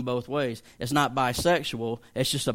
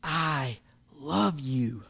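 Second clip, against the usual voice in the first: tender pastor voice; much slower than usual. Retold or imitated. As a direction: let a pastor's heart pour out gently. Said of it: "he was like".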